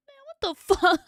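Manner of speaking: loud laugh breaking into the curse; laugh-crying shout of disbelief; laughing through tears. Real, not acted. Reactor overwhelmed by the ending, laughing through tears.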